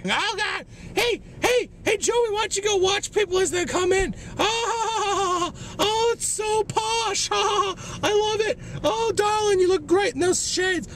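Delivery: very high falsetto, hammed-up gushing; mocking gushing clerk. Store clerk fawning over shoppers, mock gushing falsetto. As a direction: squeal in mock delight, far above your own voice.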